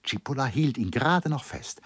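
plain reading voice

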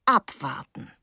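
a touch of solemn oracle; quietly portentous, understated. Acted. Paula prophesying to the girl, mildly solemn. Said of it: "sagte Paula feierlich wie ein Orakel".

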